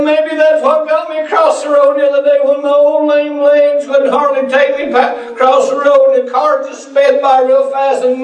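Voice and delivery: high, lightly voiced old man telling his escape; a hint of the old man, laughing along; grateful old man. Homeless man voiced in story.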